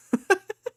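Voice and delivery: very high laughing squeal; squeal of laughter. Real, not acted. Laughing at the reply.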